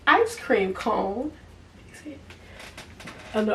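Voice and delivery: usual speaking voice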